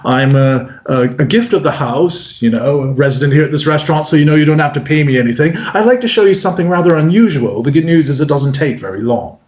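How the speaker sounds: polite, restrained British performer; brisk, unassuming British patter; understated British. Acted. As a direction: play a modest British magician introducing himself. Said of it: "The British are very understated".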